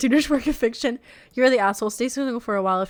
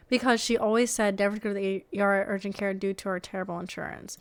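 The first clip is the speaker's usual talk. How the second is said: ordinary delivery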